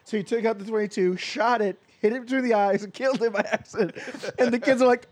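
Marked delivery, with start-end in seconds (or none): none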